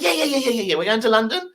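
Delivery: high rapid yeahs; eager woman. Retold or imitated. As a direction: chirp the yeahs high and quick. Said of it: "this woman's like".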